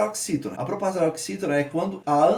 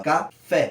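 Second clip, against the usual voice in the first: "Café" split, with FÉ standing out; ca, then a stronger FÉ; stress on FÉ; 3 dB louder than usual. Shown as a demonstration. Teacher showing the last syllable is tonic, stress on FÉ. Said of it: "uma ascendência pro Fé".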